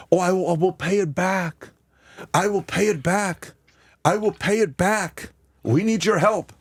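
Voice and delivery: lightly mimicked foreign leader pleading for help; a mild mock plea, promising to repay; pleading Zelensky imitation. Podcaster mocking a leader begging for aid, light pleading voice. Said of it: "just like Zelensky's videos".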